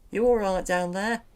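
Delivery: calling to someone stuck below. Acted. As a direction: shout down to check on him.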